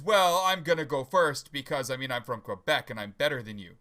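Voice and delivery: ordinary talk, nothing marked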